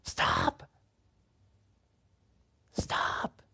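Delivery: low then whispered stop; fading whisper. Retold or imitated. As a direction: hush it down to a whisper.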